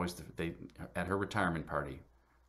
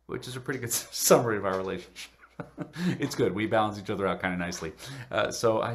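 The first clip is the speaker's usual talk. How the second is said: laughing; 5 semitones higher, 9 dB louder, faster than usual. Real, not acted. Laughing at his own marriage.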